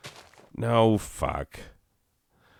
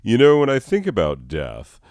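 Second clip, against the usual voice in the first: loud, higher self-parody; mock cheery opener; 4 semitones higher, 6 dB louder than usual. Speaker mocks his own morbid way to start a sentence, mock cheery. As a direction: quote yourself loudly, as if opening happy small talk. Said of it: "that's a good way to start a sentence".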